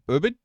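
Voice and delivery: "Ubud" as OO-bud, rising; trying a pronunciation. Shown as a demonstration. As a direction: test "Ubud" with an OO start, as a question. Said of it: "How do you say Ubud?".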